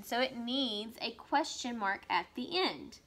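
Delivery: ordinary delivery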